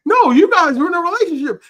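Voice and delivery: loud, high, fast retort; mock accusation. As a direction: imitate friends insisting in protest. Acted argument with accusing friends.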